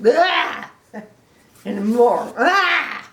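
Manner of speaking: high, loud yelp; disgusted cry. Acted. Gerald gagging.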